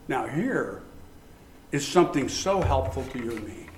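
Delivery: normal talking voice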